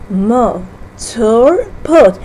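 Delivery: "Motor boat" said slowly, parts pulled apart; slow syllable split. Shown as a demonstration. Teacher slows down to show the three syllables. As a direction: spell out mo-tor-boat by ear. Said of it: "three syllable".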